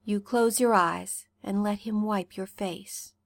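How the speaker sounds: ordinary delivery, nothing marked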